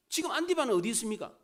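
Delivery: ordinary delivery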